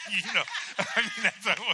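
usual speaking voice

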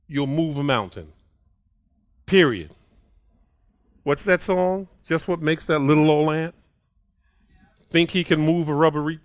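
normal talking voice

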